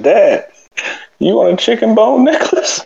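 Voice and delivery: high-pitched mock call to Dad from afar; retold yell. Son calling to his dad from the other room, mock yell. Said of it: "he just yells from the other room".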